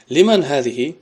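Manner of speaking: بلند و با تأکید خوانده; «لمن» را بلند و رسا بگو; loud reading. Shown as a demonstration. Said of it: "teacher reads example aloud". (خواندن بلند مثال)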